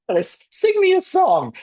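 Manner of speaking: very high-pitched British rabbit voice; British-accented rabbit. Retold or imitated. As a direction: squeak it out like a British cartoon rabbit. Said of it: "starts to talk to you in his British accent".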